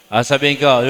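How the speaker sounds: ordinary delivery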